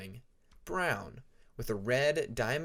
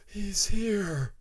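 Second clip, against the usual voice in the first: higher, louder, gasped out between breaths; breathless panic; 8 semitones higher, 6 dB louder than usual. Acted. Nightwing warns Peacemaker of the Rainwing, breathless and frightened. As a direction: blurt the warning while fighting for air. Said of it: "in between gasps".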